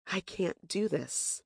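soft, slow and trembling; trembling whisper. Retold or imitated. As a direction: barely get the words out, scared. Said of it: "I whispered in a trembling voice".

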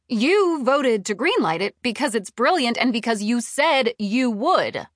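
heated, insistent reminder; indignant accusation. A narrator's reading. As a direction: throw the promise back at him, indignant. Narrator argues with her boss.

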